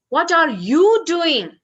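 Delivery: "you" pushed high and loud in a slow question; stress on "you". Shown as a demonstration. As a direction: lean hard on "you", then let the pitch fall. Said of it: "And we can say".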